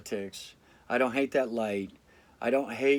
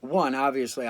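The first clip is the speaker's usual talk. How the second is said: usual speaking voice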